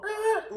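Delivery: a sharp high squeal of a scratch; high cartoon screech; mock record scratch. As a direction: squeak it out like a needle skidding.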